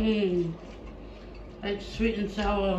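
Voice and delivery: talking while chewing; chewing food. Real, not acted. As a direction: mouth partly full. Seen 1: Woman on left eats then talks with chewing mouth, hand near lips.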